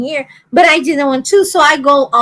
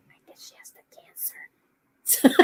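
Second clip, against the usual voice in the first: hushed, voiceless gossip; mock whisper; 15 dB quieter than usual. Retelling a stranger's remark about her bald head, whispered. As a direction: whisper it like a snide aside. Quoting an ignorant stranger's aside.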